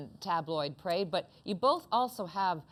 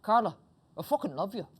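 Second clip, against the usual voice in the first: laughing mock declaration of love with a faint Irish lilt; Michael Weckerly impression; 4 semitones higher, 3 dB louder than usual. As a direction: gush it like a friend who's had a drink, half laughing. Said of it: "this is my, uh, Michael Weckerly impression".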